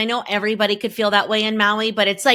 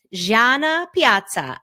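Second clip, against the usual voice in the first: best Italian voice; 3 semitones higher, slower than usual. Acted. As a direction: savor the name with Italian flair. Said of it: "say that in my best Italian voice".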